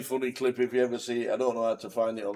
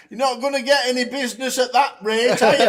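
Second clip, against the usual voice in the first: put-on higher, louder, laughing child's jab; cheeky little girl; 11 semitones higher, 8 dB louder than usual. Little girl teasing the ice cream seller, cheeky and laughing. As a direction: tease it like a cheeky kid.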